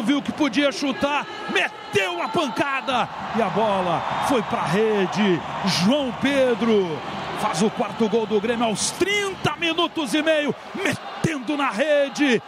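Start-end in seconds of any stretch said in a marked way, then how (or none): none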